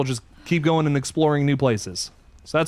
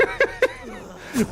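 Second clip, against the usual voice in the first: high laugh. Real, not acted. Laughs at frantic fight.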